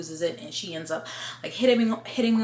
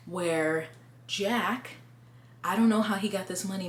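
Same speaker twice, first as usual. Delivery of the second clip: normal talking voice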